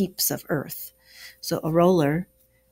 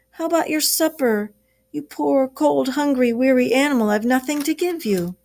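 moaning lament; 7 semitones higher, 3 dB louder than usual. Acted. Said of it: "he moaned".

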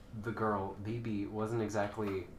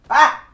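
high, very loud scream; 14 semitones higher, 19 dB louder than usual. Real, not acted. Gamer screams at the opponent, big high scream. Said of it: "I'm screaming".

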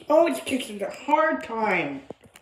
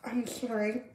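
quiet sad confession; 3 semitones lower, 7 dB quieter than usual. Acted. Mother admits losing custody.

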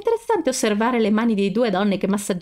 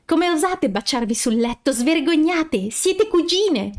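rising higher, slow hammed-up outrage; mock indignant scolding; 7 semitones higher, slower than usual. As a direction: shake a fist and scold, playfully. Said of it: "agitando un pugno".

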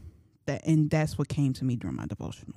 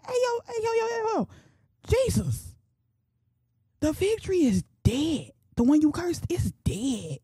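very high, stunned cry of disbelief; shocked disciple; 12 semitones higher than usual. Acted. Peter telling Jesus the fig tree is dead, shocked. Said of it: "he was shocked".

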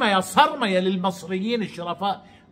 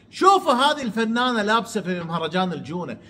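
usual speaking voice

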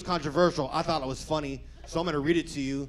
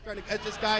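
normal talking voice

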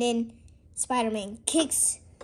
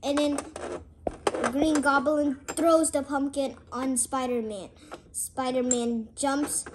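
ordinary delivery

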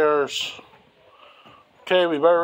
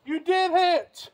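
excited praise; 14 semitones higher, slower than usual. Real, not acted. Dad cheering child on bridge.

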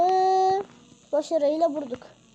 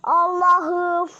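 loud, dragged-out yell; loudly showing he likes to shout; slow deliberate shout; 3 semitones higher, 6 dB louder than usual. Real, not acted. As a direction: shout it slowly on purpose. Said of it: "derken bağırayım".

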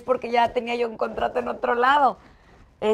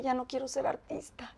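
hushed, voiceless whisper, as if confessing a secret; whispered confession; 4 semitones higher, 9 dB quieter than usual. Retold or imitated. To Manuel in the car, confessing she wants to quit, whispered. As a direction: breathe it out without voice, barely daring to say it.

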